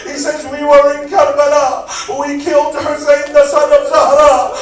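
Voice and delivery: loud, high wailing sermon cry; preaching at full force. Acted. Majlis recitation at full force.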